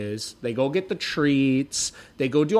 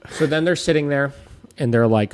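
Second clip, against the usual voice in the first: normal talking voice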